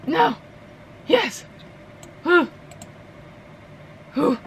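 high, short yelps; excited yelps. Real, not acted. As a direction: yelp each word high as the landing hangs in the balance. Tense landing in the game.

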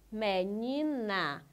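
"Menina" slowly drawn out in three beats; syllable by syllable. Shown as a demonstration. Teacher showing the word has three syllables, syllable by syllable. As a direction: stretch every syllable of "Menina". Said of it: "Eu tenho três sílabas".

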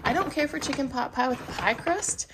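ordinary talk

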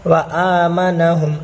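"وَآمَنَاهُمْ" as wa-aaa-ma-na-hum; long first alif in "وَآمَنَاهُمْ"; stretched alif. Shown as a demonstration. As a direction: hold the opening aa of "وَآمَنَاهُمْ", na short. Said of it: "الف کو ٹاننا ہے".

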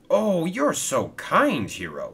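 delighted thanks, drawn out and lifted; happy. Acted. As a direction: stretch out grateful delight, then drop on the name. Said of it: "said Steven happily".

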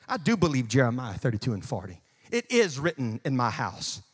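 ordinary delivery

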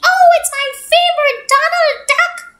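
high, loud delighted squeal; light up like a kid at cartoons; excited duck. Duck spotting his favourite cartoon on TV, high delighted squeal.

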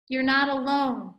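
ordinary delivery

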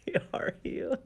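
laughing whisper. Real, not acted. Giggling through the Bible verse.

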